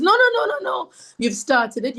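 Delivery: sharp high string of no's; insistent high lady. Retold or imitated. Lady catching her leaving the meditation, high-pitched insistent refusal.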